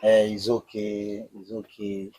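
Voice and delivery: low, very soft soothing; lightly voiced soothing wife. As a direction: murmur it gently to calm him. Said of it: "the way she's saying".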